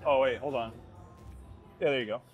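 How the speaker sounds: ordinary delivery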